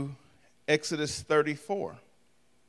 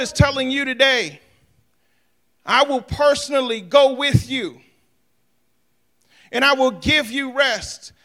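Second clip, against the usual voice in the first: loud, higher, ringing sermon delivery; full-force preaching; 8 semitones higher, 7 dB louder than usual. Acted. Pastor preaches the Lord's promise to the congregation, louder. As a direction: proclaim every promise to the rafters.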